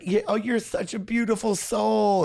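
high, sugary mock coo; cooing Malibu mom. Retelling the moms cooing at his tears, high mock coo. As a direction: coo it like a gushing stranger.

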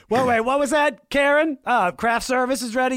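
high, loud put-on voice, half-hearted; mock distracted actor. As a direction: play a dazed actor on set, lightly mocking.

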